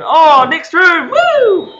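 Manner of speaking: high, loud, slow whoop; excited cheer. Real, not acted. Player cheers on reaching the next room, excited. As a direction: celebrate finding the way.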